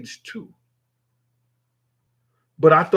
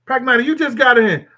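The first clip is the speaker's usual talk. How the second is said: higher and louder repeat, hitting got; louder call-out; 9 semitones higher, 7 dB louder than usual. Real, not acted. Streamer repeats his call to Pragmatic, louder and higher. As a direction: shout it again so he hears.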